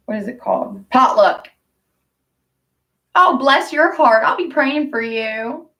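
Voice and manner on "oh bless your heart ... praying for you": lightly voiced old church lady, higher; a hint of a sweet church lady, pitched up; mock-sweet Southern church lady. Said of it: "hated how those little old ladies talked".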